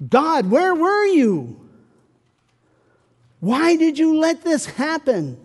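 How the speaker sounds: high, loud, slow grieving plea; grieving believer demanding answers from God; anguished questioning. Acted. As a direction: cry out to God in pain.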